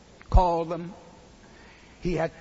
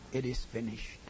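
quiet cry; 5 semitones lower, 8 dB quieter than usual. Acted. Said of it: "He cried".

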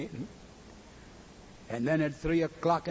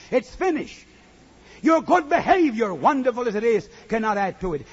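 higher, louder, driving preacher cadence; preaching at full force; 6 semitones higher, 7 dB louder than usual. Acted. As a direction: hammer it home to the congregation.

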